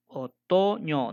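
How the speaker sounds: Otoño slowly, TO held long; stress on TO. Shown as a demonstration. Teacher showing the rhyme starts at O in Otoño, long held TO. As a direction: stretch TO in Otoño, ño set apart. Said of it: "de la O por allá".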